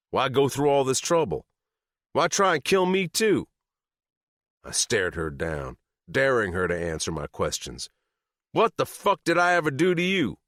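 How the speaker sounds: high, loud demanding voice; angry demand. A narrator's reading. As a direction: demand an answer. Narrator confronting Sadie.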